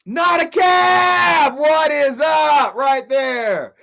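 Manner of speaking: loud, high, slow shout of greeting; hyped greeting yell; excited yell. Real, not acted. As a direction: call out to a chatter by name. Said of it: "please just stop yelling".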